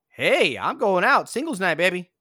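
high, loud and carefree; excited party voice. Retold or imitated. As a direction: shout it like you're off to party. Acting out freed, cheerful person.

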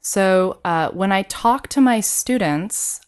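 normal talking voice

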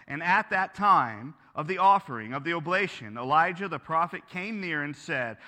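steady, measured Bible reading; scripture reading. Acted. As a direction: read the passage aloud to the congregation. Pastor reads scripture aloud.

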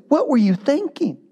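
high, incredulous cry; flabbergasted. Acted. Sailors staring at Jonah, high and incredulous. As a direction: ask it as if you can't believe it. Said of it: "They're flabbergasted".

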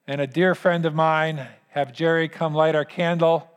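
normal talking voice

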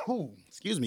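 throat still tight from coughing; recovering from a choke; choking on a drink. Real, not acted. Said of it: "It was choking me".

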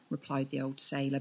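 plain reading voice

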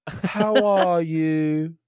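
high, very drawn-out posh British; exaggerated fancy British. Shown as a demonstration. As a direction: stretch every vowel like a fancy Brit. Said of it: "everything's so exaggerated when they talk".

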